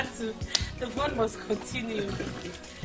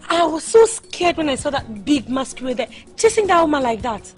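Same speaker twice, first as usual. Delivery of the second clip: loud, worked-up account of a scare; alarmed retelling; 4 semitones higher, 9 dB louder than usual. Acted. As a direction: exclaim it loudly, still rattled. Acted recounting of a fright.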